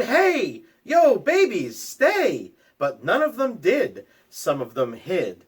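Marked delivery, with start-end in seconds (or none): 0.0-2.5 high, loud call to the babies; hollering at babies. Acted. Parent hollering at crawling babies to stay, loud call. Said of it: "You hollered".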